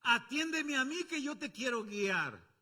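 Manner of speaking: loud, slow call for attention. Acted. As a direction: call out to be heard. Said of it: "nos gritan a viva voz". (llamado fuerte y lento)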